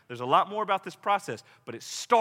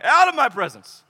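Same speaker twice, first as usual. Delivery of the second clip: mock angry dad; 7 semitones higher, 12 dB louder than usual. Father voicing angry rejection.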